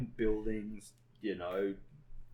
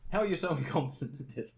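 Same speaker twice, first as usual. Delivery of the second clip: higher, louder disbelieving question; mock exasperation; 8 semitones higher, 5 dB louder than usual. Imitating coworker who saw him fall over, exasperated. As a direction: imitate someone baffled by such clumsiness. Retells coworker reacting to repeated falls.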